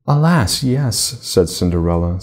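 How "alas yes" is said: sighing. Acted. Cinderella answering her godmother, a touch of a sigh. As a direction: let it out as a light sigh.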